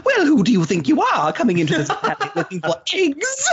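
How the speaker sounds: high, hammed-up mid-Atlantic villain sneer; cartoon villain in mid-Atlantic accent demanding who dares come looking for eggs. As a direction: play a pompous old-movie villain in mid-Atlantic, over the top. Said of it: "I have done plenty of".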